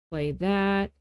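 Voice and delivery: ordinary talk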